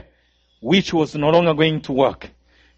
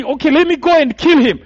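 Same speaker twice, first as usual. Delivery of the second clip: higher, louder offer; eager killer; 8 semitones higher, 3 dB louder than usual. Retold or imitated. Killer at the roadblock volunteering, higher and louder. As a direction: step forward to kill.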